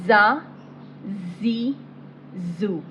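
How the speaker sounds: firm emphatic za, zi, zu; hard letter. Shown as a demonstration. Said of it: "Za is a hard letter".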